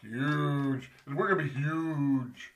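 a light take on a brash tycoon stretching huge; Donald Trump. Retold or imitated. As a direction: lean lazily into huge, like a boastful showman. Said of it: "getting all these things from Donald Trump".

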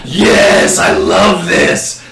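loud, high, hammed-up glee; exaggerated ecstatic cry; mock delight. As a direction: cheer it slowly, stretching the words. Acted gleeful reaction in hypothetical.

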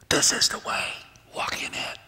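soft unvoiced whisper from behind. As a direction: breathe it like a voice at your shoulder. Voice behind you speaking.

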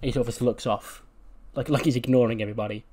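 ordinary talk

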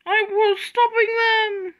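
high, loud, drawn-out wail; protesting shout. Acted. Brake van answering Oliver's yell, wailing loudly.

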